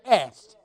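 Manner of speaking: "Asked" landed hard and loud; "Asked" as a loud, final correction; stress on Asked. Shown as a demonstration. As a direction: punch out the single word "Asked" loudly. Stresses scripture's word against crying, moaning.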